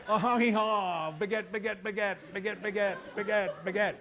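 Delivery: high, comic pretend-French babble; hammed-up fake French cries; mock French. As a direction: jabber like a panicked Frenchman, joking. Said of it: "can someone speak French?".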